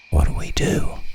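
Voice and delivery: frightened breathless whisper. Retold or imitated. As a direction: whisper it so no one hears. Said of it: "I whispered to my friend".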